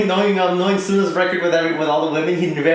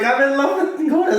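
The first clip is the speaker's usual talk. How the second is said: higher, dragged-out "Goda"; forced confession; 9 semitones higher than usual. Retold or imitated. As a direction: say it again, embarrassed but sure. Girl made to repeat confession.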